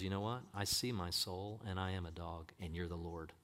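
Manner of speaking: low, soft confession; quietly voiced inner surrender; humble admission. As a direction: own up to it meekly.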